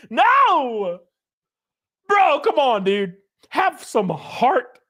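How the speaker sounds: high, hammed-up wounded whine, laughing through it; mock heartbroken whine. Streamer mock-pleading with chatter Ventalo, hammed-up high whine. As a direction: play the betrayed crybaby, way up high.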